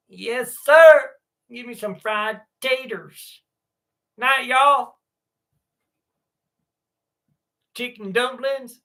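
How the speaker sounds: high, slow mock Southern voice; Southern accent. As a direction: ham up a country food lover. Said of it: "I suck at accents".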